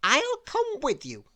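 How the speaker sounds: high bossy lady insisting. A narrator's reading. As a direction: invite herself along, high and bossy. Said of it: "said Miss Bossy".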